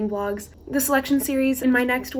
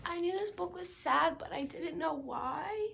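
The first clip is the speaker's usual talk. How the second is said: teary; 6 semitones higher, 11 dB quieter than usual. Real, not acted. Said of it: "I can't even talk without crying".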